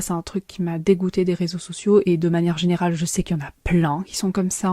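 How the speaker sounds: ordinary delivery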